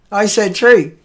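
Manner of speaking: "three" said as "tray", with t for th; dialect pronunciation. Real, not acted. Host owning up to the dialect slip, "three" said as "tray". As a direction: hit "three" with a hard t in place of th. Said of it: "that's my accent".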